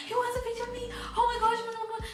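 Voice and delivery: high-pitched flustered panic; freaking out. Retold or imitated. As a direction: squeal it in a giddy fluster. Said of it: "So obviously I freaked out".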